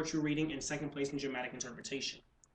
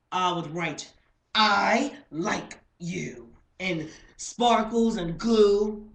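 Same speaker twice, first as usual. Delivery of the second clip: loud, high, exaggerated declaration; animated slam poetry, projected to the room; lovestruck spoken-word performance; 8 semitones higher, 12 dB louder, slower than usual. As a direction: stretch the words with theatrical gusto.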